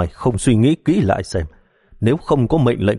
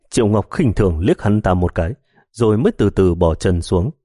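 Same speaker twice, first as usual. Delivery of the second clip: plain reading voice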